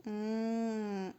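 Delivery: ordinary talk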